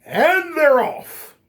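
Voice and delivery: slow, high, drawn-out call; like a race caller at the gate; race announcer. Retold or imitated. Announcing the fight's start.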